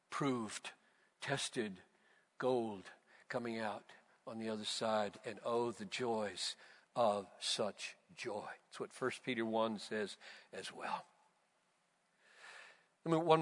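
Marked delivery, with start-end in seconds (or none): 0.1-11.0 much softer, lower, hushed; soft, tender afterglow; quiet reflection. Real, not acted. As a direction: let it fall to a hush.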